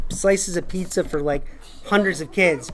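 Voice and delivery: ordinary talk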